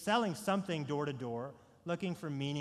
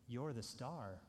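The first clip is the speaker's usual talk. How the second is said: Christof's hushed answer; 5 semitones lower, 11 dB quieter than usual. Retold or imitated. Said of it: "And Christof answers".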